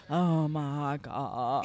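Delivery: low, very drawn-out swoon; slow, deep mock amazement; imitated awe. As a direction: stretch it out as a husband bowled over by cake. Acts husband's reaction to the cake.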